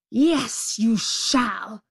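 forceful storybook rage; enraged tiger. A narrator's reading. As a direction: insist furiously as the tiger. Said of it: "in a rage".

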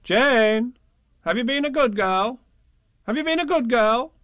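high, sing-song rising question; high-pitched, insistent grown-up; questioning parents. A narrator's reading. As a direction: quiz a small child in a high parental coo. Parents voiced questioning the child.

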